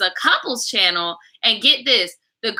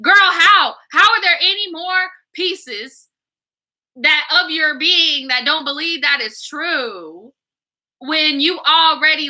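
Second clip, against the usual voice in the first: incredulous scolding; 5 semitones higher, slower than usual. Real, not acted. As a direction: call her out, stunned. Scolding the letter writer.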